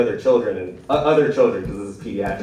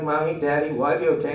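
higher-pitched hint of a worried kid; lightly voiced child; 5 semitones higher than usual. Frightened kid asking parents in hospital, lightly voiced child. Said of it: "imagine some kids saying".

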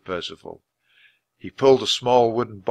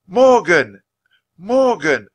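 loud, high yell of a name; 9 semitones higher, 9 dB louder, slower than usual. A narrator's reading. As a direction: yell to break the spell. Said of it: "Annie yelled".

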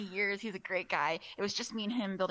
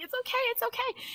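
very high-pitched mock reassurance; retold protest. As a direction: insist high up that all is well. Retold reassurance to panicking coworkers.

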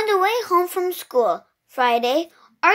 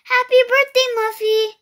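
higher, louder cheer; excited greeting; 9 semitones higher, 4 dB louder than usual. A narrator's reading. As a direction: beam it at the birthday girl. Greeting at surprise party.

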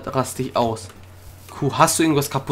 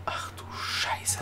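very soft, slow whisper; appalled whisper; 13 dB quieter, much slower than usual. Real, not acted. Player discovering the damage, appalled whisper. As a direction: breathe out the curse in dismay.

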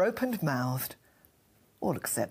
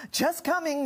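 higher-pitched answering call; 9 semitones higher than usual. A narrator's reading. Couple answer the neighbour at the door, calling out. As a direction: call out high to the visitor. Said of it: "they cried".

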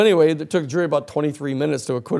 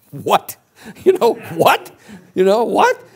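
much higher, louder, mock disbelief; shocked jury; 8 semitones higher, 6 dB louder, slower than usual. Jury hearing about the butterfly bombs, mock shocked. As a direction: gasp it out in mock astonishment. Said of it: "the jury is going".

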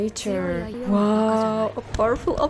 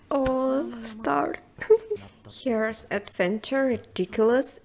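ordinary delivery

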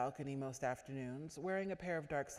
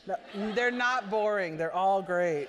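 loud laughing protest, real amusement; 4 semitones higher, 12 dB louder than usual. Real, not acted. Protests she skips no stories.

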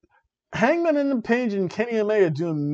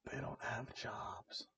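conspiratorial whisper; whispered aside; 4 semitones lower, 23 dB quieter, slower than usual. Acted. Whispering a snide aside to viewers. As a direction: lean in and whisper it like a secret.

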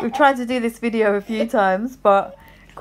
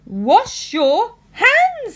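bossy maid; 11 semitones higher, 7 dB louder, much slower than usual. Acted. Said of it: "said the maid".

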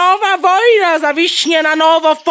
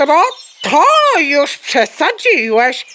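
ordinary delivery

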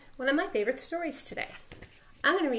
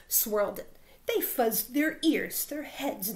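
ordinary delivery

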